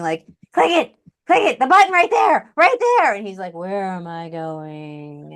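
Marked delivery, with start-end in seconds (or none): 0.5-3.1 high, loud, urgent mock screaming; exasperated scream. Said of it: "you're, like, screaming". 3.5-5.3 very drawn-out, softer mock dawdling; so slow. Imitating the slow man sharing his screen, very slow. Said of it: "people that is so slow".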